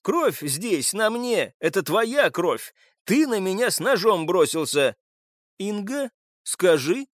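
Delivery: high, agitated accusation; heated protest. Acted. Suspect blames captive and appeals to Инга, heated.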